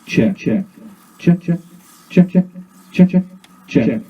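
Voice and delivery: loud mic check, echo ringing; mic check through the echo. Shown as a demonstration. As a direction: punch the mic test into the tape echo. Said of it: "you can hear we're back in business".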